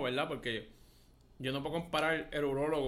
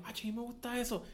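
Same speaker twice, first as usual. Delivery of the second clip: soft, higher imitation of the man; hushed imitated aside; quietly voiced quote; 6 semitones higher, 6 dB quieter than usual. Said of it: "Y después él dijo".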